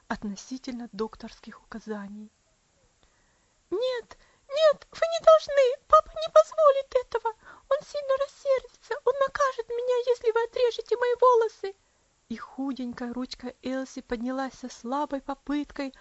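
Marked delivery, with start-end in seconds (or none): 3.7-11.7 put-on high, loud, panicked child's pleading; a feverish little girl begging them to stop; terrified girl crying out in delirium; frantic child. Delirious girl pleads against scissors.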